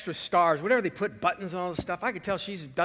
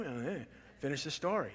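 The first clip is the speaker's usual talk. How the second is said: softly imitated audience member; curious listener; 3 semitones lower, 8 dB quieter than usual. Congregant wanting the rest of the story, quietly eager. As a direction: urge him on under your breath. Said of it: "some of you are like".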